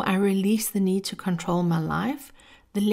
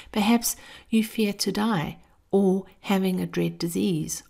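normal talking voice

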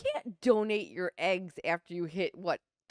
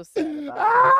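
high, loud laugh-crying; 11 semitones higher, 12 dB louder than usual. Real, not acted. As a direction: wail it through laughter. Laughing mock lament.

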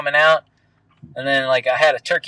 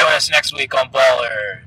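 announcer voice; 3 semitones lower than usual. Pretend. Mock show outro.

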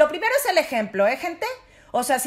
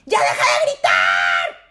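grito exagerado de mamá, más lento; 6 dB louder, slower than usual. Retold or imitated. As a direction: gritar como mamá desesperada. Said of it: "si yo le digo". (mamá regañando a gritos, imitado)

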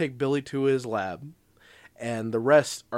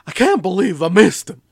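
loud, higher mock frustration; foot-stomping tantrum; 4 semitones higher, 9 dB louder than usual. Villain who missed his target, loud mock tantrum. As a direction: throw a hammed-up villain tantrum. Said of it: "he's stomping his foot".

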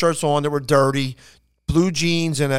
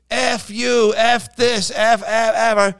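higher mock yelling of rowdy firemen; imitating hecklers; 7 semitones higher, much slower than usual. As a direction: ham up the crowd's heckles. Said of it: "They're yelling out everything".

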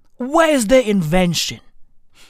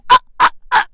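short, high, loud grunts; mock sexual grunts; 12 semitones higher, 6 dB louder, much faster than usual. Comedian acting out how pregnancy works, mock grunting. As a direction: punch out hammed-up high grunts.

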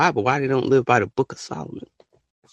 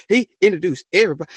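ordinary talk

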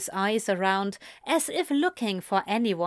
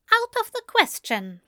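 flustered, higher-pitched refusal; managed with effort. A narrator's reading. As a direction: force out a prim refusal. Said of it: "he finally managed".

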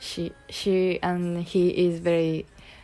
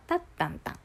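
タッタンタン high on the first beat, then dropping; standard Japanese rhythm; 5 semitones higher, 4 dB quieter, much faster than usual. Shown as a demonstration. Showing the standard pattern in nonsense syllables, standard rhythm. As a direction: tap out standard intonation as タッタンタン. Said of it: "標準語は".

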